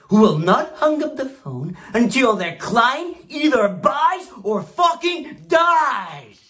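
high, forceful shout, last word drawn out; rousing shout. Acted. Boss drills brokers never to hang up, rousing shout. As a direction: build to a high, roaring battle cry. Boss rallying sales troops.

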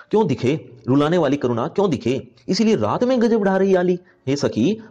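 lifted, declaiming verse voice; poem recitation. Acted. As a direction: read the couplet as poetry, rising. Teacher recites poem lines.

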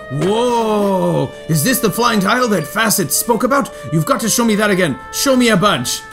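high, eager fan voice; excited NPC voice. Acted.

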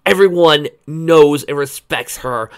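loud and slow, each word weighted; slow emphatic. Real, not acted. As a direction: lean into every word. Emphatic fan aside.

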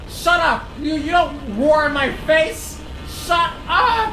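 loud, high shouted scolding; heated shout, rising at the end; angry yelling. Real, not acted. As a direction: yell back at a roaring foe. Yelling at a roaring opponent.

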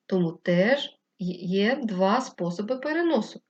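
ordinary talk, nothing marked

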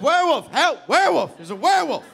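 high, loud repeated cries; frantic shouting for help; panicked yelling. Acted. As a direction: scream for rescue, hammed up. Yelling for help while pinned.

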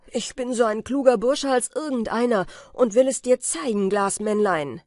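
laut und höher, gekränkter Trotz; trotziger Ruf. Acted. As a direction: prahlt laut, um seine Klugheit zu beweisen. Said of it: "rief jener". (Peter ruft dem Glasmännlein laut und trotzig zu)

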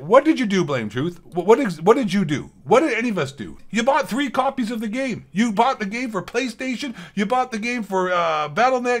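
getting really worked up; fired up rant. Real, not acted. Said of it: "I got a little fired up there".

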